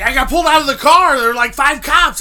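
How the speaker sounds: high, loud indignant outburst; agitated yelling. Retold or imitated. Imitating his friend pulled out of the car, agitated yelling. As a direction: shout the grievance as the distraught friend.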